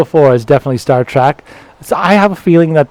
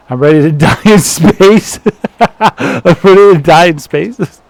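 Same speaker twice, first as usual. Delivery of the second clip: loud mock-eager declaration breaking into laughter; loud and laughing; 3 semitones higher, 5 dB louder, slower than usual. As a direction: belt it out, cracking up. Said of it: "I know it was loud".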